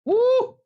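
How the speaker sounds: loud, high-pitched "woo"; sudden high woo; high whoop. Real, not acted. Reacting to the week-long penalty.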